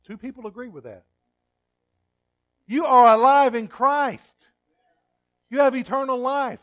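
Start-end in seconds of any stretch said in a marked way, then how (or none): 2.7-6.6 fervent proclamation. Real, not acted. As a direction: proclaim it to the whole church. Preacher proclaiming to congregation.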